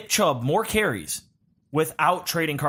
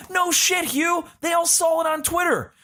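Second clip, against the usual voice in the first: sarcastic outburst; 10 semitones higher than usual. Real, not acted. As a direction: scoff it sharply. Sarcastic retort to coach.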